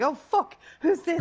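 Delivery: high, loud retold shock; startled alarm. Player retelling a stranger's name popping up, startled. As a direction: blurt it out, re-enacting total bewilderment.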